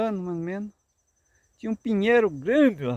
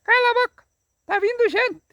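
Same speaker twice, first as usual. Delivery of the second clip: much higher, louder tiny parrot voice; thin little voice; 4 dB louder than usual. Acted. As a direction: squeak it like a small bird warning the flock. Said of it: "uma vozinha fina".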